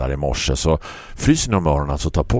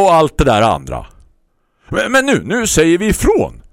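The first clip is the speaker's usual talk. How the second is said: much higher, louder, urgent neighbour; eager neighbour; 6 semitones higher, 3 dB louder than usual. Acted. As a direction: announce it like a call to action. Neighbour's impassioned speech.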